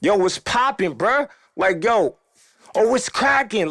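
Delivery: high, forceful street challenge; aggressive tone. Shown as a demonstration. Storyteller shows how his people press someone, aggressive tone. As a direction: demonstrate an aggressive greeting. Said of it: "it's an aggressive tone".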